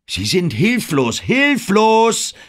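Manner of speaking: rising from pressing to a high, loud cry on hilflos; director's voice climbing into a high cry; building urgency. Acted. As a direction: push the last word up into a near-shout. Director whips up her panic.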